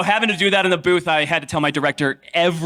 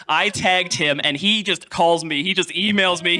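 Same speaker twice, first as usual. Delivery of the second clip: normal talking voice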